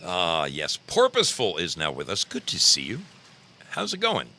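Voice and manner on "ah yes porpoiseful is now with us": ordinary talk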